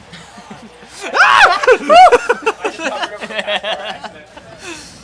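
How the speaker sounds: loud, very high shriek breaking into laughter; scream, then laugh it out. Real, not acted. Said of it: "I'm screaming".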